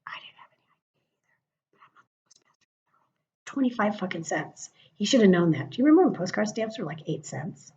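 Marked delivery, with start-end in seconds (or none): 0.1-3.1 a sly confession, barely voiced; whispered aside. Real, not acted. Admitting her own ignorance to viewers, in a whispered aside. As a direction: whisper it behind your hand.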